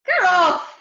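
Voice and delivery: loud howl of protest. Acted. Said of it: "howled Abdul".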